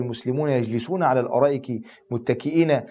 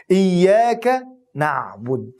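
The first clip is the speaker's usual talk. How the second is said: slow emphatic declaration; 5 semitones higher, 3 dB louder, much slower than usual. Acted. Climactic declaration of worship.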